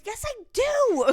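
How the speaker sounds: high slow whine; mock whiny. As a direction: whine like a stubborn roommate. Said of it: "She goes".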